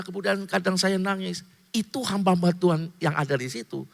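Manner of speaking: ordinary talk, nothing marked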